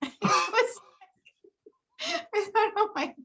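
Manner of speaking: high-pitched, talking through laughter; laughing. Real, not acted. Actress recalls a fan's tactless remark, laughing. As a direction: laugh through the words in amused disbelief.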